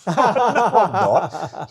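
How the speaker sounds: slow, high, loud laughing protest; laughing refusal. Retold or imitated. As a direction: drag out a giggling no.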